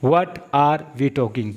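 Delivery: very slow, every word stretched; teacher's drawn-out example; slow demo. Shown as a demonstration. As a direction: drill it slowly for learners. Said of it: "इसको समझिए".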